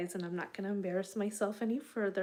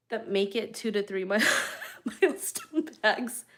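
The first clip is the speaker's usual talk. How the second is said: laughing; 3 semitones higher, 5 dB louder than usual. Real, not acted. Laughing at her bag count.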